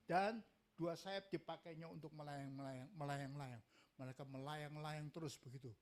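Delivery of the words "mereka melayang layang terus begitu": ordinary delivery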